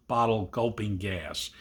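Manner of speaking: usual speaking voice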